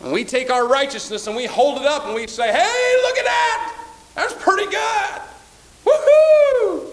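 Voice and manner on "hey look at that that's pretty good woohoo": high-pitched mocking boast; mock self-praise. Preacher mimicking a proud churchgoer, high and gleeful. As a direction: lampoon the self-righteous.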